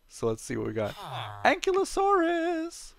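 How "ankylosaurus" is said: much higher, slow drawn-out cry of joy; excited. Real, not acted. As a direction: shout the name out in delight. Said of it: "So excited about that".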